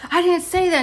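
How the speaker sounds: retold indignant protest, pitched high; high, heated mock-argument denial; mad denial. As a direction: protest your innocence in an acted-out squabble. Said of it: "mad in the face".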